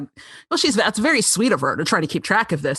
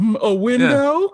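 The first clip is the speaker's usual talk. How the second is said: mock Ludlow; 3 semitones higher, 4 dB louder, slower than usual. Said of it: "I was like".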